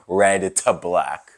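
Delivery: mock solemn slow repeat; said again slowly. Shown as a demonstration. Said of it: "we're gonna say it again".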